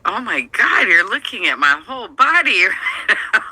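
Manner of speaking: high-pitched mock shock with a laugh. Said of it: "I felt like".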